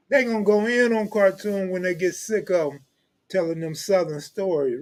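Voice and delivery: ordinary talk, nothing marked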